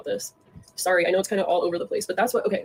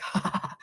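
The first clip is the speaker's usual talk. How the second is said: mocking, breathy "ha ha ha"; mock laugh. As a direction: imitate someone laughing in your face. Acting out friend's taunting reply.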